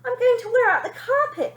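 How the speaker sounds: very high, loud panic; mother panicking on the stairs; panicky call. Acted. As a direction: cry out in a fluster. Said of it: "called Mother in something of a panic".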